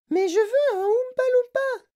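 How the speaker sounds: put-on very high, whiny, demanding girl's voice; spoiled brat Veruca. A narrator's reading. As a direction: nag daddy until he gives in. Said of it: "hurla Veruca".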